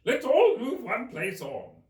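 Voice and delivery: high, loud, slow mocking Hatter. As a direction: needle her slowly and loudly.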